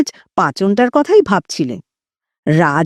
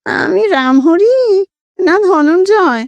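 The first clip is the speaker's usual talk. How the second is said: high, slow nasal whine of a ghost; high nasal ghostly voice; 8 semitones higher than usual. A narrator's reading. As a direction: play a timid ghost speaking through the nose. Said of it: "খোনা স্বরে".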